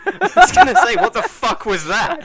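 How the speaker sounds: high, loud laughing disbelief. Real, not acted. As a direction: crack up at a weird voice. Incredulous laughing reaction.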